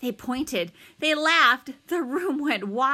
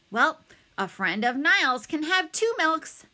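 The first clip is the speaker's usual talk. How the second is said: a higher lilt for a lunch lady; lightly voiced lunch lady; 7 semitones higher, 4 dB louder than usual. A narrator's reading. Lunch lady granting Niles's friend extra milk, lightly voiced. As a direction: lightly play a friendly server, pitched up.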